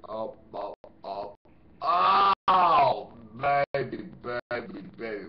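loud hammed-up moaning; mock moan. Mocking the stalker with crude jokes, hammed-up moan.

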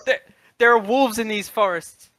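put-on high, loud, frantic American accent; trapped man yelling in an American accent. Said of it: "I have an American accent".

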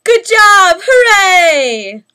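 high loud shout; excited cheer. Real, not acted. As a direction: cheer loudly with joy. Cheering after the candle is blown out.

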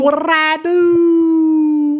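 loud, high mimicking howl, hugely drawn out; mocking wail. Admin talking over the banned player's question, mocking wail. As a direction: parody a protest with a held, wailing last word.

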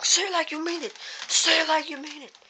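high, loud egging-on; insistent urging. Acted. Bandmate pumping up Luigi's confidence, insistent urging.

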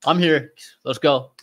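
punchy, high-pitched impression of hurrying in; brisk announcement. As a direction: announce yourself loudly and move right on. Acting out brisk arrival.

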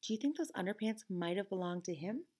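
usual speaking voice

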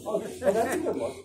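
ordinary talk

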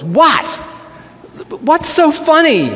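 high-pitched baffled questions; puzzled. Acted. Abraham asks laughing Sarah what's funny, puzzled. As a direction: jump up in pitch, not getting the joke. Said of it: "Abraham looks puzzled and goes".

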